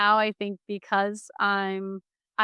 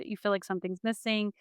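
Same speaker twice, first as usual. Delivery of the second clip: normal talking voice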